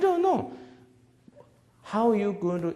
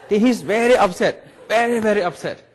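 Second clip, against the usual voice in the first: louder, laughing through the words; 5 semitones higher, 9 dB louder than usual. Real, not acted. Speaker recalling the boy's upset, laughing loudly. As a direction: chuckle while telling it.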